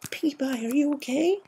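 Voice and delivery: high gentle pony voice; Fluttershy voice. A narrator's reading. Fluttershy worried over Pinkie.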